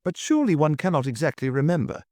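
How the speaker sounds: a touch of doubtful hesitation; heavy hesitation. A narrator's reading. Chairman doubting the duke's memory, hesitant. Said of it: "began Mister Audley with heavy hesitation".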